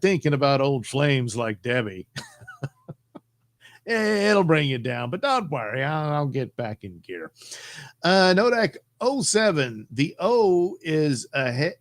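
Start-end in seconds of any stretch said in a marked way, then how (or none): none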